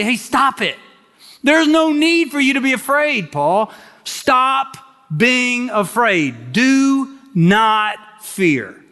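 high, emphatic plea, slowing at the end; fervent preaching. Acted. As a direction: urge Paul not to fear, drawn out. Voicing the Lord to Paul.

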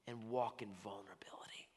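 soft whispered close. Acted. God inviting the believer to vulnerability, tender whisper. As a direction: drop to a whisper. God's plea ends in whisper.

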